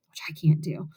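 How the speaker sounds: low, whispered aside; hushed aside. Real, not acted. Reader confessing she can't do the accent, hushed aside. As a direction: drop to a quick whispered admission.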